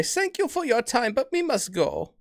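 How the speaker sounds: lightly voiced granny taking her leave; old lady voice. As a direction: play the old woman politely leaving. Grandma thanks shopkeeper.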